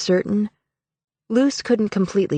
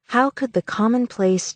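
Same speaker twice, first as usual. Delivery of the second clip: ordinary delivery, nothing marked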